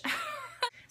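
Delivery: quick high-pitched chuckle; a brief laugh at your own remark; short laugh. Real, not acted.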